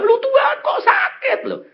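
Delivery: high, loud imitated protest; mock grumbling. Sick woman grumbling to God, mock high-pitched complaint.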